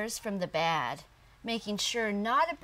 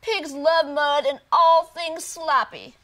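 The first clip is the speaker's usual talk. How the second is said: loud, higher, pushy frog; insistent frog voice; 6 semitones higher, 11 dB louder than usual. Acted. Frog argues with Otis.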